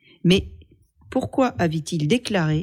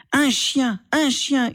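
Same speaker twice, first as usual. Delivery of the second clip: pitched-up yell of contempt; shouting; 8 semitones higher, slower than usual. A narrator's reading. Asma screams back at her brother, pitched-up yell. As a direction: spit the word chien out high. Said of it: "hurla Asma".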